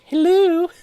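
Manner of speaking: high, loud mock Scottish call; mock Scottish accent. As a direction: mimic an exaggerated Scottish hello. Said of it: "sounded suspiciously Scottish when you did that".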